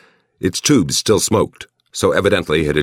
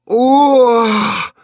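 high loud cry of pleasure; passionate cry; 6 dB louder than usual. A narrator's reading. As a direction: let out a sudden loud ooh. Anne cries out in passion.

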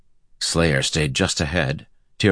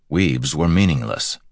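plain reading voice

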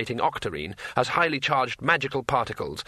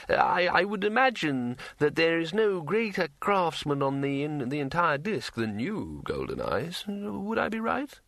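higher, wavering pitch, rising to the question; halting arch astronomer; 5 semitones higher than usual. A narrator's reading. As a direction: stammer out a leading compliment as ruler.

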